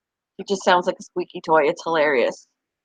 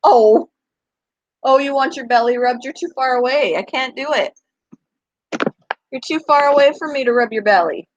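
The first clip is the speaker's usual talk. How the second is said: a bit higher, playful coaxing voice; pet talk; 5 semitones higher than usual. Real, not acted. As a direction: tease the dog into coming closer. Talking to the dog about belly rubs.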